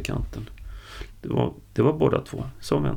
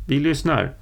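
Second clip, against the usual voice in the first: raised, higher call; 4 semitones higher, 4 dB louder than usual. A narrator's reading. Karl calls from the pit to the man, calling out. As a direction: call up to someone out of sight.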